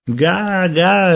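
put-on high baby "ga, ga"; baby babble. A narrator's reading. Kenneth repeating his sound to Peter, high baby voice. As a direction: babble like a toddler. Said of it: "ripeté Kenneth".

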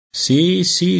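high-pitched humouring aunt; zia accondiscendente. A narrator's reading. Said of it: "concordò la zia Laura". (zia che dà ragione al bimbo)

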